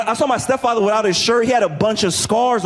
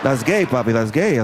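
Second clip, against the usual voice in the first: put-on low-pitched accented stepfather voice; dismissive stepfather; 7 semitones lower than usual. Stepfather dismissing the idea to his stepson, low and dismissive.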